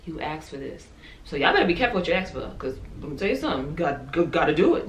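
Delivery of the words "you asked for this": much softer, hushed voice of God; subtle whisper. Retold or imitated. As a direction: let it drop to a soft hush. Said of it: "subtle whisper".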